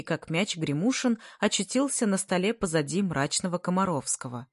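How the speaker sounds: ordinary delivery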